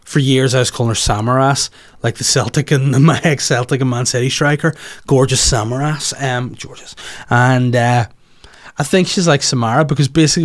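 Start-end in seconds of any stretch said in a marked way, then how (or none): none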